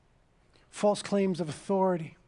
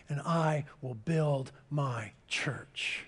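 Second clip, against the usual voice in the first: slow, hushed whisper, much softer; 6 semitones lower, 7 dB quieter, much slower than usual. Acted. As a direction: breathe "I will build my church" like a secret promise. Quiet close of Jesus' quote.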